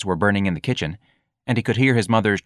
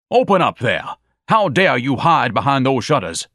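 higher, louder indignant shouting; angry shout; 5 semitones higher, 5 dB louder than usual. Acted. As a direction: yell it out the window in fury. Ignatius shouting out the window.

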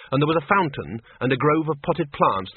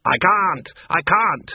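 loud, higher cry of helplessness; panicked shout; 5 semitones higher, 4 dB louder, slower than usual. A narrator's reading. Arthur shouting back from the spinning trolley, panicked. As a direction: shout it back, pitch raised.

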